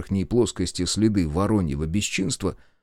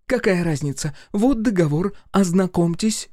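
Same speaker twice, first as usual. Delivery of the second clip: indignant Masha; 9 semitones higher than usual. A narrator's reading. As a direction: snap back indignantly. Masha's voice continues.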